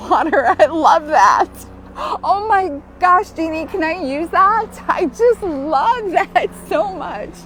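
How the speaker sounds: loud, laughing and delighted. Real, not acted. Reacting to Jeannie's ocean comment, laughing delight. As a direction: burst into laughter at the image.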